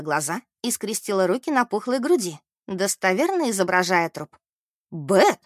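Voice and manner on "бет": a short, mildly scandalized reproach; indignant exclamation. A narrator's reading. Mary scolds Beth for mimicking a corpse, indignant. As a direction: call the name in understated outrage. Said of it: "Мэри возмущённо уставилась на подругу".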